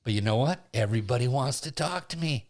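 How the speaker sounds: normal talking voice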